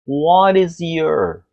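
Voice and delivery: linked T to R, What stressed; careful linking demo; slow linking. Shown as a demonstration. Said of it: "Som do T pro som do R".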